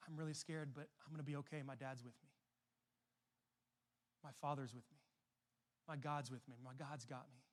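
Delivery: soft, hushed words of trust; quiet prayer. Shown as a demonstration. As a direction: say it softly, as if praying to yourself. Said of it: "we can say".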